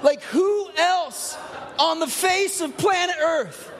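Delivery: slow, drawn-out disbelief with big pauses; incredulous rhetorical outrage. Real, not acted. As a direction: let each phrase land in theatrical astonishment. Mock outrage at Jesus saying woman.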